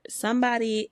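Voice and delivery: usual speaking voice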